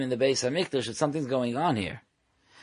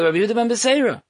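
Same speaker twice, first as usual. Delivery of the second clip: high, loud, rapid naming; imitating an eager reply; eager answer; 10 semitones higher, 5 dB louder, much faster than usual. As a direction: blurt the name out high and fast. Guy naming who sent him.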